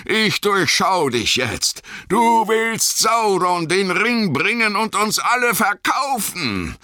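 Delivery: high-pitched raging tirade; wütendes Brüllen. A narrator's reading. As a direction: bellow threats and curses in full fury. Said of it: "brüllte er". (wütend gebrüllte Verwünschungen)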